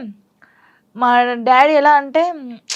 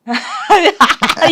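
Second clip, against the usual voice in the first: loud bursting laugh; burst of laughter; 5 semitones higher, 7 dB louder than usual. Real, not acted. Laughing out loud at the evil-eye breakup joke. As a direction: crack up loudly at the idea. Laughs at her own story.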